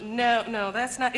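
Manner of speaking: usual speaking voice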